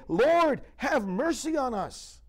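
high, loud plea for mercy; crying out. Retold or imitated. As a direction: cry out to be heard over the crowd. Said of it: "They cried out all the more".

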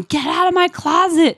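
loud, high-pitched mock yell; retold yell. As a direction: imitate an outraged shout from the clip. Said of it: "like, yelling".

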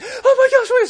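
very high, loud mock squeal; hammed-up squeal of delight to friends; excited squeal. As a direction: imitate a breathlessly excited fiancée. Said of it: "they're so excited".

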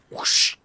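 imitated whooshing blade noise; hissed whoosh, no pitch; whoosh sound effect. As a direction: make a sharp whoosh noise. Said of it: "You just, like".

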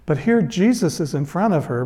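normal talking voice

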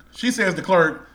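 ordinary talk

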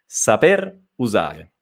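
slow "saper usare", "saper" stopping at the R; unhurried "saper usare", no vowel after the R; smoother dropped E. Shown as a demonstration. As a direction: show how "saper usare" flows without the extra E. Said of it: "Suona meglio".

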